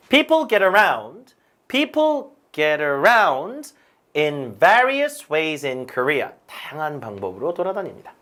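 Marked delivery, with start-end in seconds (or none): 0.1-3.7 slow model sentence, "around" punched; deliberate teacher demo with emphatic "around"; stress on "around". Shown as a demonstration. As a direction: say it slowly and lean on "around". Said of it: "제가 계속 강세를".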